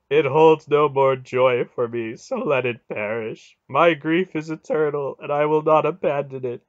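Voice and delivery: loud, grieving god's lament; anguished groaning. A narrator's reading. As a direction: cry it out in bitter despair. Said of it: "Mara groaned".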